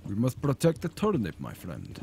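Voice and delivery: lightly hammed-up fake Russian accent; a mock-solemn vow in a bad Russian accent. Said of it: "In a bad Russian accent".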